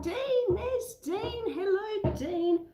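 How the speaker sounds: high, sing-song hello to a friend; cheery high-pitched hello to a viewer who just joined; delighted greeting. Real, not acted. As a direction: call out a bright, lilting welcome. Greeting a viewer joining live.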